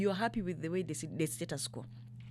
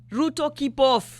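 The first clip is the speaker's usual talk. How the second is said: louder, slow and firm; forceful warning; 5 semitones higher, 8 dB louder, slower than usual. Real, not acted. Telling President Ruto to stay out, loud firm warning. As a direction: lay down the law, word by word.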